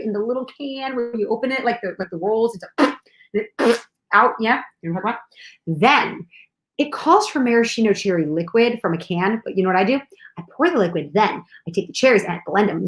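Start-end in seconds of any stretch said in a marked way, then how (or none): none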